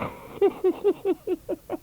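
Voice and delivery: very high puppet cackle; high Punch cackle. A narrator's reading. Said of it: "thin, high voice like Punch".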